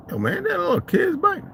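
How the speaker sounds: sudden high recognition; surprised. Retold or imitated. Noticing the thief rides a child's bike, surprised. Spotting the stolen kid's bike.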